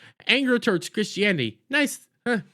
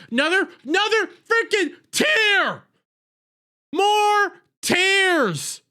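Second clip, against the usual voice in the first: much higher, yelling in disbelief; exasperated shout; 9 semitones higher, slower than usual. Real, not acted. Recapper fed up with another crying scene, shouting. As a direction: throw up your hands and yell about it.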